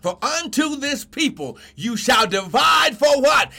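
rising, emphatic sermon cry; full-force preaching. Real, not acted. Preacher telling sons of their inheritance, full-force preaching. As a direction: build the verse into a proclamation.

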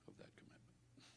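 breathy whisper, barely there. Real, not acted. Preacher finishing his point to the church, hushed whisper.

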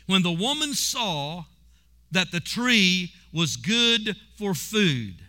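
stretched out, each word set down; slow scripture read. Acted. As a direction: read it slowly, letting it land. Reading scripture slowly.

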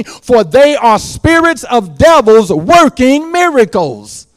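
loud, high preaching cry; full-force preaching. Acted. Preacher warning the congregation about the devil, loud. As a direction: warn the flock with fire.

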